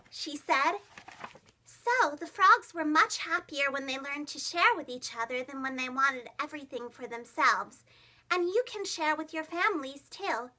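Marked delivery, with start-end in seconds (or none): none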